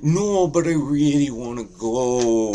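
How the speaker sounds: high, loud, drawn-out little-brother whine; whining Canada. Acted. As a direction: whine slowly to be taken along. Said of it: "And Canada's like".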